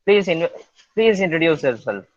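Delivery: normal talking voice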